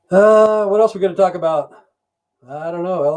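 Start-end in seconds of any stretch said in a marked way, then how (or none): none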